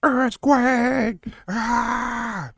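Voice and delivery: high yelped cry of alarm; mock earthquake cry. Host yelping at shaking camera. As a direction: yelp as if the floor shook.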